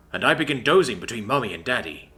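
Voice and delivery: ordinary delivery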